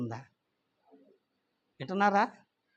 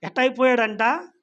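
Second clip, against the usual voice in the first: louder, higher rising proclamation; preaching loudly; 6 semitones higher, 6 dB louder than usual. Real, not acted. Preacher booms the Lord's beauty to congregation, loud. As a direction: proclaim it to the whole church. Preacher rises to forceful climax.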